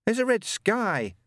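high, slow, manic edge; a touch of mania; slightly manic. A narrator's reading. Newt back from the shower, slightly manic. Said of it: "feeling slightly manic".

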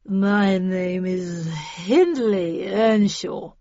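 put-on very slow, dragged-out drunken slur; drawling, sozzled introduction; slurring drunk. A narrator's reading. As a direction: slur your name out like a drunk. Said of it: "slurred the drunk".

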